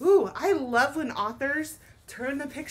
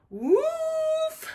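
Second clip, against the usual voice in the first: very high loud whoosh; high falling whoosh; 5 dB louder than usual. A narrator's reading. Teacher voicing the tree crashing down. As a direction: fling the sound up high.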